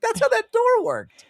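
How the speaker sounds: squeaky high, mock-amazed; mock narrator voice. Retelling the narrator's line to a friend, squeaky high. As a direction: pitch it way up for the punchline.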